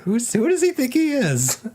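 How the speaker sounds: high-pitched comic disbelief; mock indignation. Co-host imagining the raided streamer's reaction, high mock indignation. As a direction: squeal it in put-on outrage.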